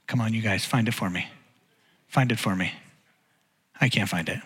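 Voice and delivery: quiet, low-pitched searching aside. Real, not acted. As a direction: ask softly and low while hunting for the name. Searching for forgotten name, asks audience.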